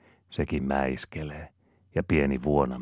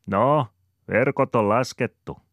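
raised, louder voice for Aapeli; Aapelin ääni; 9 semitones higher, 7 dB louder than usual. Acted. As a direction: call it out brightly to Ananias. Aapeli speaks to Ananias. (Aapeli huikkaa Ananiakselle kovemmin)

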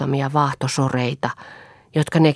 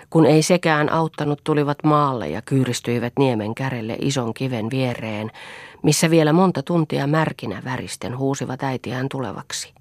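ordinary delivery, nothing marked

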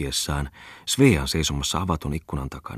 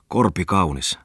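usual speaking voice

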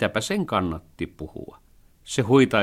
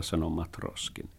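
ordinary delivery, nothing marked

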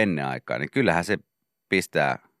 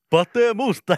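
high, loud rock intro yell; intro shout; 6 dB louder, much slower than usual. Retold or imitated. Cohost imagines [name] opening every Popeda song with a high loud yell. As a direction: belt out the name like a song opener. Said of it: "jokaisen Popedan biisin alkuun huutaa".

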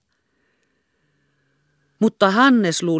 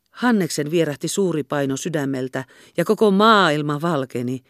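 ordinary delivery, nothing marked

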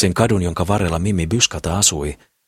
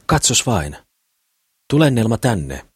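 higher-pitched, lightly exclaimed call; exclaiming; 5 semitones higher, slower than usual. A narrator's reading. Mimmi calling Nelma to see the visitor, exclaiming. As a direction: call out with mild excitement, pitch lifted. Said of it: "huudahti Mimmi".

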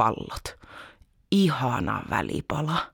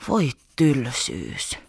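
bored sigh; 3 semitones lower, much slower than usual. A narrator's reading. Bored child sighs.

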